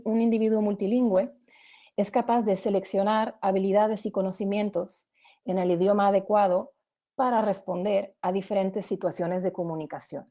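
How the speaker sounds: ordinary delivery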